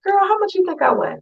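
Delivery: a hint of a sassy friend; asking a friend. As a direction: playfully pose the question. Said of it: "Ask your friend".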